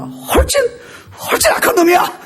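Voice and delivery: high-pitched, hammed-up accusation; retold rebuke. Preacher retelling his rebuke of a young man, mock-indignant cry. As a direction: scold with comic exaggeration.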